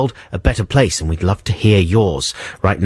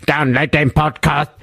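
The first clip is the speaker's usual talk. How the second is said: computer voice; 5 semitones higher than usual. Pretend. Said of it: "and go".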